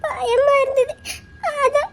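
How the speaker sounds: wife confessing she was afraid; scared and crying. Acted. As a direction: admit it in a small frightened voice. Wife admits fear, crying.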